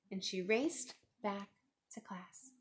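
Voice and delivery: usual speaking voice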